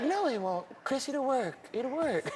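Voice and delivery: gentle insisting in a hushed high voice. Retold or imitated. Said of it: "He's like".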